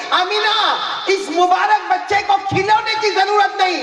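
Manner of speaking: high, loud, ringing call from above; heavenly voice. Acted. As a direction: announce it like a voice from the sky. Divine voice to Amina. (आसमान से आती ऊँची, बुलंद आवाज़)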